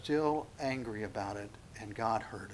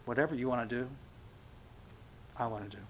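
soft prayer; 8 dB quieter than usual. Retold or imitated. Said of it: "come before the Lord and just say".

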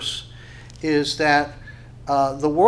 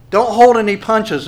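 emphatic preaching; 7 semitones higher, 9 dB louder than usual. Real, not acted. Preacher pressing the point.

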